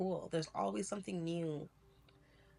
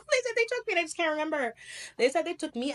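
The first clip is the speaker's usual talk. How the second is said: laughing; 11 semitones higher, 6 dB louder, faster than usual. Real, not acted. Laughs at therapy revelation.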